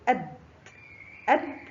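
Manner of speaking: "أد" as short a plus released d; sukun on daal. Shown as a demonstration. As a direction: say "أد" stopping on d with no vowel after it. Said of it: "حرف الدال فوقه سكون ينطق". (نطق "أد" بدال ساكنة)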